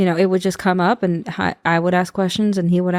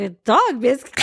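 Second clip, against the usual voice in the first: confused echo; 5 semitones higher than usual. Retold or imitated. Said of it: "He was very confused".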